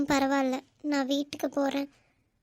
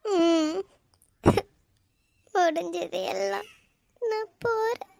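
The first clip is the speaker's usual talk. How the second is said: crying goodbye; 5 semitones higher, slower than usual. Acted.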